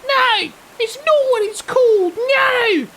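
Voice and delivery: very high squeaky outraged shriek; Lit impression. On request, streamer imitates Lit yelling, very high. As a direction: shriek no in mock fury. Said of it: "Do your Lit impression again".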